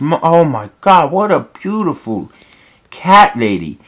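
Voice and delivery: mildly awestruck admirer; Daddy Derek. Acted. Cat's dad seeing the waitress, mild smitten wonder. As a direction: lightly act out being smitten.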